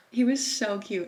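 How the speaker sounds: ordinary talk, nothing marked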